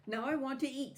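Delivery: high, loud demand; spoiled doll wants lunch now; bossy doll. A narrator's reading. Doll demanding lunch from tired Natasha, high and bossy.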